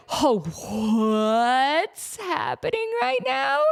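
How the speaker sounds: slow, drawn-out astonished question; hammed-up amazement. Whole audience reacting to the surprise cards, exaggerated amazement. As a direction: stretch it out as a mock crowd reaction.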